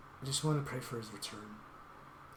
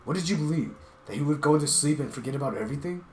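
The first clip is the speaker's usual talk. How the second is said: much louder and higher, shouting in rage; a raging outburst at a foolish friend; furious yelling; 3 semitones higher, 11 dB louder than usual. Acted. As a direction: scream at him to open his eyes. Scolding the leopard furiously.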